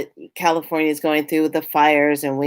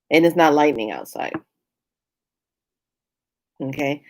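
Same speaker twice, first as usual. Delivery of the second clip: ordinary talk, nothing marked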